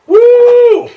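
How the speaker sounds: high, loud whoop; excited whoop. Real, not acted. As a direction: let out a startled whoop. Smoke getting into the air.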